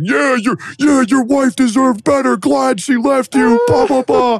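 high-pitched imitation of a screaming rant; mock screaming. Streamer mimicking the critic who screamed at him, mock screaming. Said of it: "starts screaming at me".

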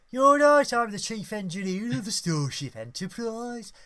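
high mock Brummie engineer; Birmingham accent. Brummie chief engineer of the Starship Enterprise, Birmingham accent. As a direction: announce it loudly in comic Brummie, easing in volume. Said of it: "I cannot take that accent".

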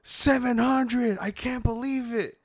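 high, drawn-out scream of disbelief. Retold or imitated. Coworker screaming the contract figure to the speaker, high-pitched yell. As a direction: imitate a coworker yelling slowly at top pitch. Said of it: "he screams out".

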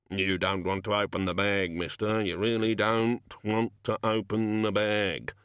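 level, droning gnome voice, slowing down; warning gnome. Acted. Gnome warning the wizard about his bag, ominous.

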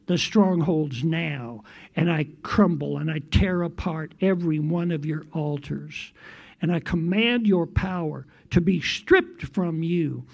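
low, intense spiritual decree; laying a curse. Acted. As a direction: command the spirits down, low and firm. Low intense decree against demons.

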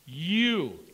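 You said firm and pointed; emphatic You. Acted. Jesus telling the disciples You, emphatic. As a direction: land the word You as a command. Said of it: "It's in the emphatic".

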